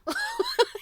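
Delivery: high, loud real laugh; short squealing laugh; burst of laughter. Real, not acted. Laughs at bat boy quilt.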